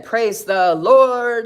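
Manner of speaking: loud, drawn-out praise the Lord; joyful church-style praise; exclaimed praise. Retold or imitated. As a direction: cheer it out slowly, loud and high. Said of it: "Serinda said".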